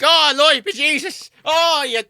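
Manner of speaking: high, loud, hammed-up Irish folk exclaiming; mock Irish accent. Irish villagers react to the date turning into a horse, mock Irish accent. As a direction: wail it in a put-on Irish accent. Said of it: "let [name] do the Irish accent".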